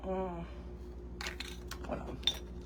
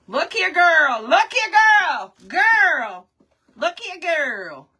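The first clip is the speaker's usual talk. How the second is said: loud, high, drawn-out call; insistent raised-pitch holler; 6 semitones higher, 8 dB louder, much slower than usual. Real, not acted. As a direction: holler it to get her attention. Calling out to get attention.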